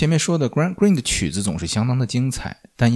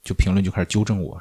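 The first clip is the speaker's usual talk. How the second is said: ordinary talk, nothing marked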